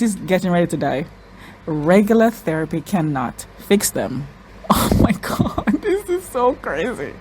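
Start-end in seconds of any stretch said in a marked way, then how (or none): 4.7-7.1 laughing and crying at once; real tearful, high, drawn-out disbelief; overwhelmed, near tears. Real, not acted. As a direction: squeak it out slowly, voice giving way. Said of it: "Oh God, I'm crying".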